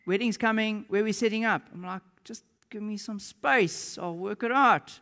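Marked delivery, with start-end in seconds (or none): 0.1-1.6 raised, louder, urgent question; insistent pressing. Retold or imitated. Team presses him again. 2.2-5.0 high, drawn-out plea getting louder; exasperated. Retold or imitated. Groom fending off his team.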